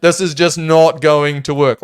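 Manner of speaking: dismissive reaction. Retold or imitated. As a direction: act out an emphatic dismissal, word by word. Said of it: "I'm just like".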